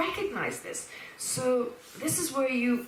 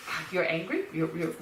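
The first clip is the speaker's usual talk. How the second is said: mock-patronizing, lower-pitched; 7 semitones lower than usual. Teacher showing how not to answer anger, patronizing. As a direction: talk down to them, put on. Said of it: "Instead of kind of being patronizing".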